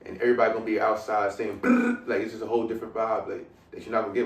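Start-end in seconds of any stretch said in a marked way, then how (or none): 1.6-2.1 high excited brrr call; brrr cheer. Retold or imitated. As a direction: shout the brrr like a crowd. Mimicking crowd shout, high.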